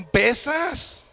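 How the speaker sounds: "pesas" said high, stretched and plaintive; ant whining. Retold or imitated. Ant tells the elephant he's heavy, high plaintive "pesas".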